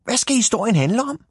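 high, quick, curious question; high-pitched, impatient curiosity; eager little hero. Acted. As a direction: pipe up fast in a small character's voice.

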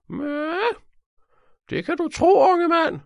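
put-on very high, shrill old cleaning lady; shrill hissing old woman. A narrator's reading. Old cleaning lady answers young detective, hissing shrilly. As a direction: hiss it out in a piercing old-lady voice. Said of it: "hvislede hun med sin skingre stemme".

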